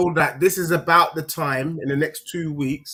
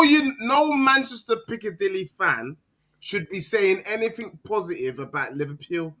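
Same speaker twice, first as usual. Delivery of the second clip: usual speaking voice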